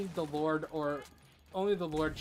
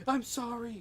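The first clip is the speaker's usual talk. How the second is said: a retold scream, only a little raised; mild mock yell; 8 semitones higher, 3 dB louder than usual. Man back from the dead yelling sorry. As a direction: blurt the apology a bit louder. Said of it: "he screams".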